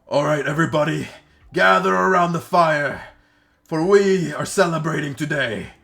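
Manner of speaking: loud, high goblin chief rallying the camp; goblin chief's proclamation. Acted. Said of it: "you see the goblin chief come up".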